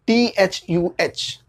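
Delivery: टीएचयूएच spelt out slowly, letter by letter; the as "thuh". Shown as a demonstration. Teacher spelling the sound for students, slowly. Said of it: "टीएचई का दो pronunciation होता है".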